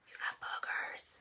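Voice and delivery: put-on hushed whisper close to the baby. Repeats line to baby.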